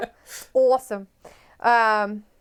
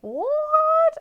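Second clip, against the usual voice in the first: high, loud disbelief; loud shocked what; 4 dB louder than usual. Real, not acted. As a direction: echo the shock loudly. Shocked by Andrade news.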